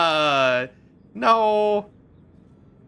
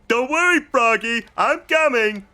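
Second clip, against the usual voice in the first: much higher, louder, dopey, cheerful cat voice; Big the Cat impression; 11 semitones higher, 5 dB louder than usual. Streamer imitating Big the Cat calling to Froggy, loud and goofy. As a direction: call out like a big friendly simpleton. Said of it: "is the same guy who's going".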